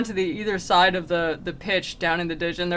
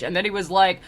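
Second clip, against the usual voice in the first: usual speaking voice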